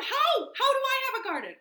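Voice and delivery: high, quick, excited Toad; eager questioning. A narrator's reading. Toad asking Frog for a garden, eager questioning. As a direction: burst out high, then rush the question. Toad begs to learn how.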